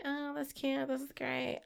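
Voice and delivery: soft, high, unimpressed thanks; mock a lukewarm thank-you; dismissive politeness. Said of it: "the person's like".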